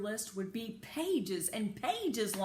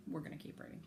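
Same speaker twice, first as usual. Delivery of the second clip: whispered aside; 3 semitones lower, 8 dB quieter, faster than usual. Real, not acted. Aside to listeners while reading.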